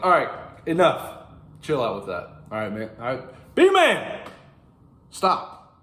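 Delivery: hammed-up fed-up god telling him to stop; Proteus scolding. Proteus telling weeping Menelaus to stop, mock scolding shout. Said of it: "Proteus is like".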